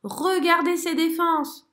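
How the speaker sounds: aigu et fort, lent; excité. A narrator's reading. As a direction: montrer avec enthousiasme. Zigomar excited. (merle excité et enthousiaste)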